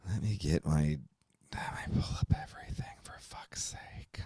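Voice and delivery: real muttered whisper under his breath; whispering to himself. Real, not acted. Host digging up his notes off-mic, whispering. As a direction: whisper it while hunting for notes.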